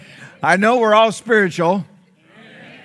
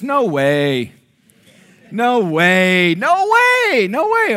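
mounting disbelief; 2 semitones higher, 3 dB louder, much slower than usual. Retold or imitated. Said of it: "Have you ever said this?".

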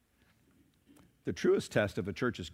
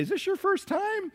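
sweet greeter voice. Retold or imitated.

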